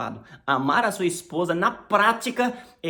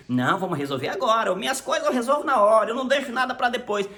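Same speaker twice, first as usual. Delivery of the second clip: insistent; 5 semitones higher, 4 dB louder than usual. Retold or imitated. Stubborn spouse insisting.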